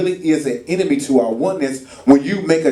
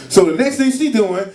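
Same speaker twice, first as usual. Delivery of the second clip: ordinary delivery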